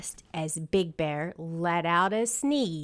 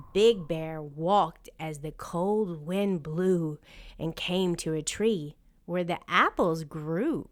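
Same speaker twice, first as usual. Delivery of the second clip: ordinary delivery, nothing marked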